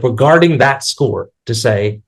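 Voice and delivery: ordinary talk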